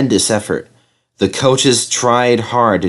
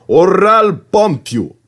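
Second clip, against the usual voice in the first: cross threat; 10 semitones higher, 4 dB louder, slower than usual. A narrator's reading. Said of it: "I'd say".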